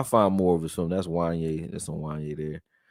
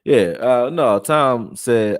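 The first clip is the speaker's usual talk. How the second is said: normal talking voice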